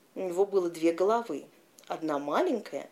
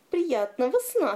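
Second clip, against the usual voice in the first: second head, higher and louder; higher giant head voice; 8 semitones higher, 3 dB louder than usual. A narrator's reading.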